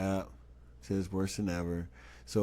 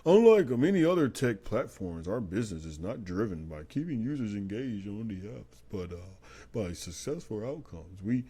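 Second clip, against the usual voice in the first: mock spokesperson. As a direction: parody a spokesperson reciting canned lines. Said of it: "this is how they sound in my head".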